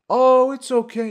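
high, loud mock soothing; hammed-up cooing vampire; reassuring vampire. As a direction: croon comfort like a theatrical vampire. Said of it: "the vampire is like".

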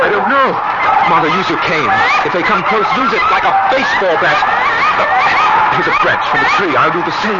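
high, loud, urgent commands; frantic shouting. Acted. Husband telling his wife to fight the birds, shouting. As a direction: shout orders over the chaos.

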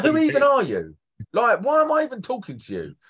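high, outraged disbelief; incredulous. Retold or imitated. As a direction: squeak it out in disbelief. Retold confrontation, repeated higher.

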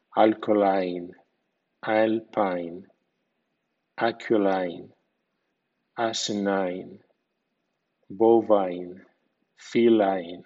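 alkaline, alpine, feline ending in "ain"; English pronunciation demo. Shown as a demonstration. Teacher models ine endings said ain, careful pronunciation. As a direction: end alpine and bovine with a clear "ain". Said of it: "suena ain".

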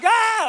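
sudden high, loud mock shriek; imitated panicked scream. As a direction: shriek like a kid going crazy on the ride. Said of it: "Like, going crazy".

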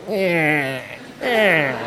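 soft, low mechanical eh eh of a stuck wiper motor; quiet imitated whine of a failing wiper; imitating wiper motor. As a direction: mimic the dead motor straining. Voicing the broken wiper motor.

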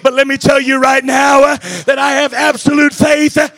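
high, loud, slow climactic shouting; preaching at full force. Acted. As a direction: roar it out to the congregation. Preacher at peak intensity.

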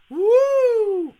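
very high, loud wrestler whoop; Ric Flair. Acted. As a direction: let out a long, piercing "woo". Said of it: "Gotta have Ric Flair".

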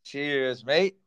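normal talking voice

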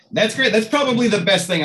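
genuinely thrilled by the answer; excited. Real, not acted. Host reacts to the promo.

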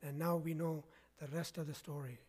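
low, hushed radio-style sign-off with pauses; Paul Harvey. Retold or imitated. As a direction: lightly echo a storyteller's closing catchphrase, softly. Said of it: "as Paul Harvey would say".

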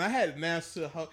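usual speaking voice

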